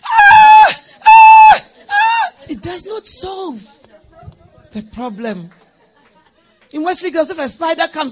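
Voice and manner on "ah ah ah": very high shrieking screams; panicked screams. Retold or imitated.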